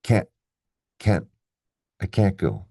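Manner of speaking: "can't" with full vowel, cut off before the T; unreleased T in "can't". Shown as a demonstration. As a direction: stop the T of "can't" without releasing it. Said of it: "Do you hear the T very clearly?".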